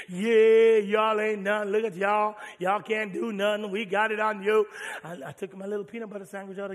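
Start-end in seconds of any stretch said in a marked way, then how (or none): 0.1-4.7 loud singsong jeering; taunting enemies. Retold or imitated. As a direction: taunt them from the walls. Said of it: "They still taunting me".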